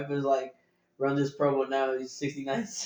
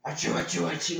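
imitating sneezes; 4 semitones higher than usual. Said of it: "he's like".

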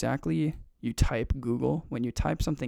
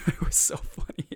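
laughing; 5 semitones higher than usual. Real, not acted. Laughing at forgetting.

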